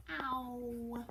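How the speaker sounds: usual speaking voice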